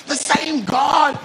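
high, impassioned preacher's cry with drawn-out words; fervent preaching. Real, not acted. Preaching at full force.

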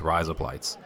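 "rise up lights" run into Aussie "razor blades"; lightly faked Australian, repeated as practice; Australian accent demo. Shown as a demonstration. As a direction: softly demonstrate an Australian accent trigger phrase. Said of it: "think of it in Australian accent, it sounds like razor blades".

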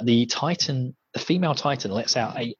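normal talking voice

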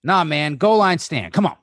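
rising, excited sportscaster call; mock play-by-play. [name] clowning with the football, excited commentator.

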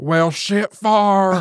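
high, slow Southern drawl on far; Grandma's Southern saying. Retold or imitated. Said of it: "I thought".

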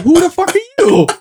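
high-pitched baffled heckle; mock incredulity. Voicing theater students to a visiting actor, mock incredulity. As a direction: imitate students who are totally unimpressed.

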